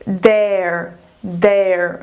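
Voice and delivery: "there" with a short open E, low; teacher modelling "there" for students, short E. Shown as a demonstration. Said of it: "E essa daqui".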